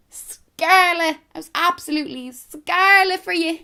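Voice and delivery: high, loud, broad Dublin exclamation; thick Dublin accent. Shown as a demonstration. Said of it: "in that thick Dublin accent".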